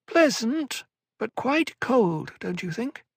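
high, slow, pleading little whine; whining elfin voice. A narrator's reading. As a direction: beg like a wheedling elf. Tleilaxu Cytail's voiced lines.

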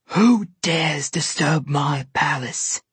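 slow, cold, imperious queen voice; commanding Snow Queen. A narrator's reading. Snow Queen challenging the unseen intruders, slow and imperious. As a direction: draw each word out like a blind queen on her throne. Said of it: "the Snow Queen demanded".

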